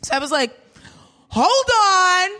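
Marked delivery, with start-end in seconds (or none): 1.3-2.3 high, emphatic, slowed-down declaration; indignant protest. Retold or imitated. Telling the cab driver her rights, indignant. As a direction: stand on your rights, pitched up and deliberate.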